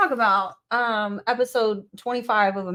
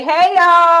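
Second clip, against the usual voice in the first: loud greeting; 8 semitones higher, 11 dB louder than usual. Retold or imitated. Kamala voiced stepping up loudly.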